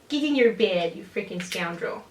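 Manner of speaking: talking to the cat. Retold or imitated. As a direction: scold the cat in cat voice. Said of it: "I'm using my cat voice still".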